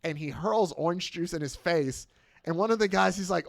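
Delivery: ordinary delivery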